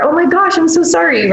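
high, sorry and soothing; apologetic soothing. Retold or imitated. Parent soothing a toddler in the car seat, high and apologetic.

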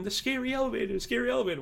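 put-on high-pitched little kid voice, excited; imitating his kid. Dad imitating his toddler about the ride, high kid voice. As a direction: squeak it out like a three-year-old. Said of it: "my three-year-old kid's going".

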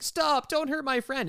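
high, drawn-out mock plea; Xavier. Podcaster retelling a friend begging the villain, high mock plea. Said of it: "Xavier, classic friend, reaching in".

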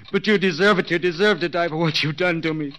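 jealous husband raging at his absent wife; bitter rage. Acted. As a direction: hurl it at her with rising fury.

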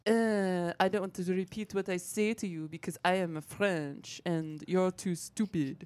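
haughty mock French accent. Mimicking a mumbly Frenchman who won't repeat himself, haughty French accent. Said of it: "love that impression of him".

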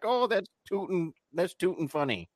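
high, lighter folksy imitation; imitated New Englanders. Maine family reacting to his speech, high folksy mimicry. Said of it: "they would just say".

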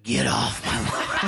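put-on very slow growled old-man line; a lightly done Eastwood, dragging it out; Clint Eastwood impression. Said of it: "I used to be able to do a Clint Eastwood".

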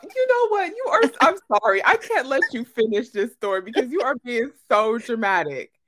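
high, loud, laughing-edged outburst; mock exasperation. Real, not acted. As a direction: cut your friend off with playful outrage. Mock exasperated interruption of friend's story.

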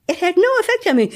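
high, fast laughing repeat. Real, not acted. Repeats line laughing after laugh.

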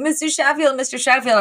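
high-pitched searching call; mock calling out. Fran running through the house looking for him, mock calling out.

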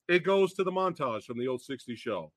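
ordinary talk, nothing marked

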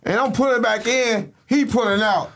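high-pitched, animated storytelling; excited retelling. Retold or imitated. Telling the host the funny part, high and excited. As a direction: build up to the punchline.

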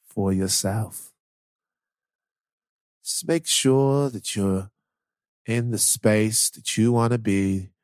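calm, slow, low guided meditation; creepy. Retold or imitated. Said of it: "the Headspace guy".

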